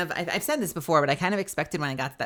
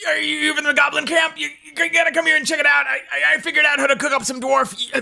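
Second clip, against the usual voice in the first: high, loud, stammering goblin shout; eager goblin cook pushing a dish; 10 semitones higher, 6 dB louder, faster than usual. Acted. Goblin offering cooked dwarf to a visitor, shouted goblin voice. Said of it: "these goblins are something else".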